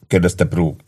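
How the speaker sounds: usual speaking voice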